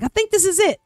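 high, eager, half-sure; suddenly recognising her own song; excited realisation. Retold or imitated.

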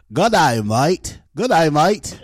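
hammed-up Australian greeting; Australian accent. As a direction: put on a broad Aussie accent, slowly. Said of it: "I did do a good day".

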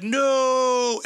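high, drawn-out mock wail; long imitated cry of dismay. Paint-covered player learning about his water bottle, mock dismay.

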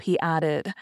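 usual speaking voice

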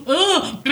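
high exasperated ugh; disgusted groan. Retold or imitated. Claire reacting to the lord, disgusted groan. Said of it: "And Claire goes".

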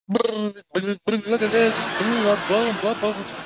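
put-on high, slow giggly drunk voice; mock laughing drunk. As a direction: laugh it out like a wasted kid. Drunk friend found in puddle.

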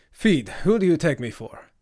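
indignant. Acted. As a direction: fire it back, affronted. Hassan reacts to shocking question.

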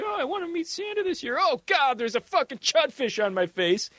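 put-on high, breathy little kid squeal of a wish. Mocking a kid at the fountain, high little-kid voice. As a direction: play the wide-eyed child tossing a penny.

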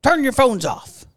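imitated scolding call; stern mock warning. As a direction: lay down the rules loudly, in imitation. Retelling Whitney's stern warning.

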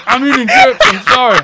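loud, high excuse through laughter; laughing apology. Real, not acted. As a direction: apologize while cracking up, voice shooting up. Excuse while cracking up.

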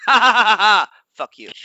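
high, loud mock villain laugh; gloating driver. Imagined driver mocking the team that booted him, gloating laugh. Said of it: "he'd be like".

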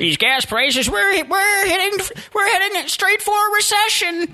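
squeaky panicked falsetto over gas prices; shrill it out like a panicking pundit; high mock voice.

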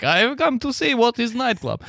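high mock Russian old lady; wide-eyed old woman at her first club; high old lady voice in a Russian accent.

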